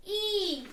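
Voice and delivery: teacher demonstrating the I sound; letter sound. Shown as a demonstration. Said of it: "What is the I sound?".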